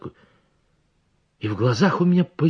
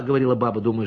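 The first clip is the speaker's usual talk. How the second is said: ordinary delivery, nothing marked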